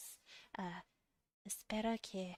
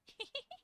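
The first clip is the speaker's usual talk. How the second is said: high whispered giggle; tiny breathy laugh; 6 dB quieter than usual. Real, not acted. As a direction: giggle softly. Giggling into ASMR mic.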